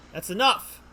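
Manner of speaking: loud, high "That's enough!"; mock outburst. Reading the lawyer stopping the officer, loudly. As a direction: cut in sharply as the lawyer.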